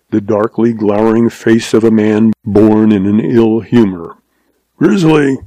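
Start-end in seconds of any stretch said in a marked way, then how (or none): 4.8-5.4 higher, mildly sharp summons; snapped. A narrator's reading. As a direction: snap it out like an impatient old boss. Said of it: "snapped old Goodnight".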